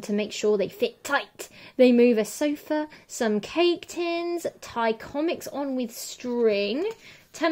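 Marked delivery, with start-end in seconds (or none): none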